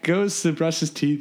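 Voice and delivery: normal talking voice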